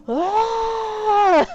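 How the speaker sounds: high, loud drawn-out "Ah!"; long scream of gamer frustration; raging scream. Retold or imitated. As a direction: let out a re-enacted yell of rage. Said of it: "he heard me raging".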